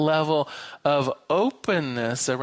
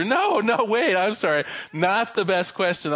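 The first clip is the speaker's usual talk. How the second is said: higher, hurried-sounding mock plea; lightly acted apology to the bartender; pleading man; 6 semitones higher than usual. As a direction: plead to stay, pitched up.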